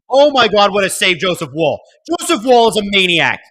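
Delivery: high, loud excited outburst; shouted, high play-by-play excitement; thrilled save call. Real, not acted. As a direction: erupt in amazed praise for the goalie. Live reaction to a huge save.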